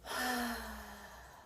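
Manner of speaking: long, airy sigh out through the mouth; deep exhale. Shown as a demonstration. Instructor demonstrating deep breaths to listeners, long exhaled sigh. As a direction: let out a slow cleansing breath. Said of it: "breathe out of the mouth".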